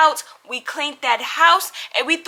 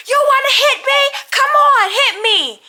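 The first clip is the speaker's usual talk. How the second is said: much higher, louder mocking yell; mock shouted provocation; 12 semitones higher, 5 dB louder than usual. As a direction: imitate her shouting a dare. Roommate provoking in hallway.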